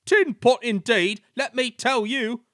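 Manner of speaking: indignant engine. A narrator's reading. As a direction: bristle at the insult.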